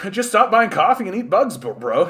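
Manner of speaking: high, loud mocking bro voice; mock bro advice. Streamer mocking bootstrap advice in a smug, loud bro voice. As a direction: sneer it like a smug hustle bro.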